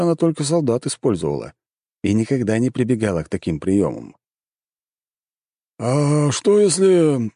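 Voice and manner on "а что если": slow, drawn-out, higher-pitched wondering; musing. A narrator's reading. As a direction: stretch it out as an idea forms. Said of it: "задумался советник императора". (задумчиво, протяжно)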